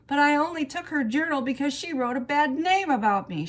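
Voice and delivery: high, fast, loud protest; defensive childish self-justifying; arguing. A narrator's reading. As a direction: argue back in a rush. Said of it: "May kept on arguing".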